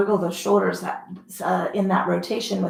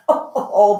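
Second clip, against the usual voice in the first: ordinary delivery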